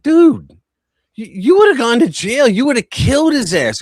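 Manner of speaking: loud, high urgent retold yell; urgent shout. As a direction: shout him back to his senses. Narrator scolds kid, retold.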